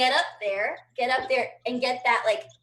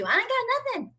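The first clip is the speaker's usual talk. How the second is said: whiny; 11 semitones higher than usual. Real, not acted. Complaint punchline.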